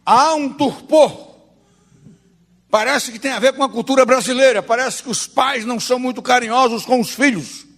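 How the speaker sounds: high, rhythmic pulpit cadence; impassioned preaching. Real, not acted. Preacher faulting the Brazilian church over worship, impassioned.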